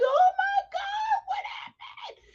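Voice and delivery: very high, drawn-out mock squeal; retold alarm. Retelling the moment she started crying, high squealed alarm. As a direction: act out the alarm in a squeal that fades off.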